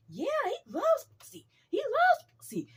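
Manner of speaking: very high, loud mock enthusiasm; imitated gleeful boasting. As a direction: crow it as the hyped-up brother. Brother's boast, retold with gusto.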